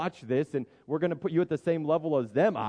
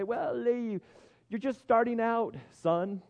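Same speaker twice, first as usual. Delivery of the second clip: higher, light mock-fatherly pat on the head; a touch of condescending parent; patronizing dad; 10 semitones higher, slower than usual. Father talking down to his young son, mildly patronizing. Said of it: "I might say".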